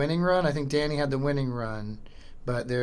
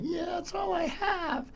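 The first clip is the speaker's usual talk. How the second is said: much higher, slow, silly squeak; goofy high voice; 3 dB quieter, slower than usual. Retold or imitated. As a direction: play it as a cartoonishly innocent reply. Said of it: "I'm like".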